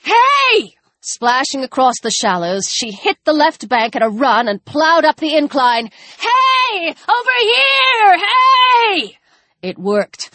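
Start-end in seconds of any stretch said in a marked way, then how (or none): 0.0-0.7 high, urgent shout; sharp high call from a distance. Acted. Calling out to distract attacker. 6.2-9.2 high, drawn-out yells; slow, high-pitched distraction shout; urgent shouting. Acted. Shouting to draw attacker away.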